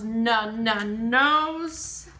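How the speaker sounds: "na, na, nose" in separate beats; first sound repeated. Shown as a demonstration. Teacher stressing the starting n, "na, na, nose". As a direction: tap the n twice before a held "nose". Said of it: "The na is at the beginning".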